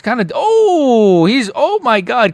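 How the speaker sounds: high, stretched gasps of amazement; excited exclaiming. Real, not acted. Streamer watching a fighter dominate, excited exclaiming. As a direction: break off mid-sentence, amazed at the action.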